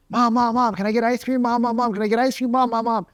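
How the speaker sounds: put-on high, pestering kid voice; begging child. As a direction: nag Mom over and over like a little kid. Kid begging mom for ice cream.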